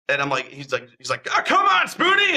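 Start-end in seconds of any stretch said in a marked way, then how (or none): 1.3-2.4 high, loud, gleeful call; much higher, louder come on Spoonie; eager shout. Retold or imitated. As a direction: yell it like a kid egging on a friend. Host eagerly urging on a reluctant guest.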